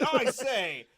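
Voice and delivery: hammed-up indignant British gentleman; British officer. British soldier objecting to guerrilla tactics, hammed-up British accent. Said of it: "That's like the British expecting".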